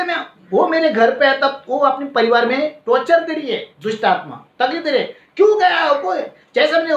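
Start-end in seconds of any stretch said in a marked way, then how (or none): 5.4-5.9 high retold shout, louder than his own voice; possessed woman yelling. Possessed woman screaming at the pastor coming near, high mock shout. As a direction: imitate her shrieking at the pastor, raised high. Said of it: "वहां पर वो चिल्ला रही है".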